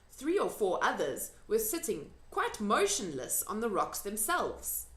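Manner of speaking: ordinary delivery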